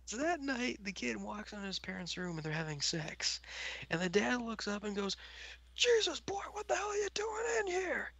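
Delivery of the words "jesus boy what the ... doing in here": high, whispered startled dad; pitched-up whispered cry; startled dad whisper-shout. Acted. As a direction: hiss it like a shocked father. Dad catches kid walking in.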